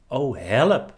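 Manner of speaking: a loud, mock-panicked plea; cry for help. A narrator's reading. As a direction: call out for help as the struggling little leaf. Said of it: "riep het blaadje".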